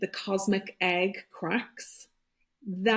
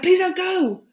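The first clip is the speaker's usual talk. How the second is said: loud, high-pitched cry of pleading; shouted plea; 12 semitones higher, 8 dB louder than usual. Retold or imitated. Daughter calling to the priest leaving her dying dad, shouted plea. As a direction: shout it out, begging them to stay. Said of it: "I just shouted".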